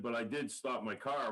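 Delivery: ordinary talk, nothing marked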